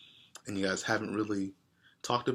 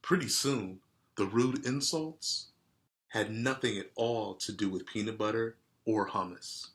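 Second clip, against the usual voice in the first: plain reading voice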